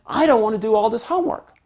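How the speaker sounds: high, quick protest; child's complaint. Acted. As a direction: lightly whine like a reluctant kid. Voicing child's real complaint.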